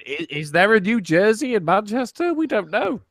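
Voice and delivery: lightly voiced puzzled onlookers; puzzled listeners. Imitating everyone sat there wondering, in a lightly puzzled voice. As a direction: act out a baffled audience, lightly put on. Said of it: "Everyone's just sat there going".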